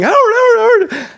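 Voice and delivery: high, loud panicked yell; screaming for brother. Retold or imitated. Little brother calling his older brother for help, screaming. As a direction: scream his name in terror. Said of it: "and I'm screaming".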